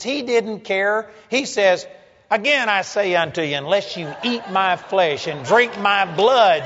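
high, loud pulpit preaching; fiery preaching. Acted. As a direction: drive the point home to the congregation. Preaching at full force.

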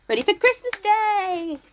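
high-pitched excited announcement; bursting with Christmas excitement. Real, not acted. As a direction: call it out with rising festive glee. Cheering on Christmas morning in game.

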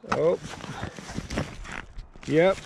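ordinary talk, nothing marked

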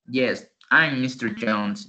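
slow, clear "I'm" contraction. Shown as a demonstration. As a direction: model the "I'm" slowly. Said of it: "Ya no dice". (pronunciar "I'm" contraído, despacio)

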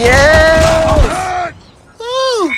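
high, loud whoop of triumph; real excited shout after winning the fight; victory shout. Real, not acted. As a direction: cheer out loud after the kill. Celebrating a kill in game.

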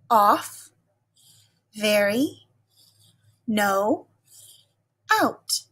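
一个词一个词慢慢领读; 像老师领读生词卡那样一个个念; reading word list aloud. Shown as a demonstration. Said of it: "Let's read them together".

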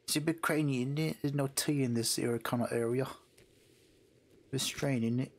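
put-on Cockney chatter; mock British accent. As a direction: chat in a hammed-up British accent. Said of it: "What accent should I do?".

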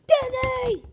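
loud, high call to dad; high-pitched holler for daddy. Real, not acted. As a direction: shout for a parent across the house. Child calls out for father.

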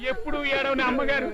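comic wail building at the end; hammed-up weeping; crying. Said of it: "నేను ఏడుస్తున్నానండి".

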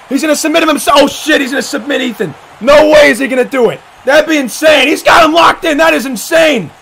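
high, urgent ringside call; excited play-by-play. Real, not acted. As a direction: call it in mounting disbelief. Live play-by-play.

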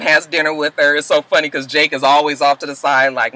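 ordinary delivery

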